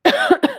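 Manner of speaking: sharp dry cough; a quick tickly throat cough. Real, not acted. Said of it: "I've got a tickle in my throat".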